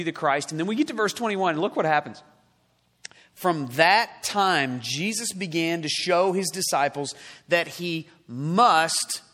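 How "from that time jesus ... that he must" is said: slow, deliberate reading landing on "must"; stress on must. Shown as a demonstration. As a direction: draw out "must" with weight after a slow lead-in. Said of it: "underline the word must".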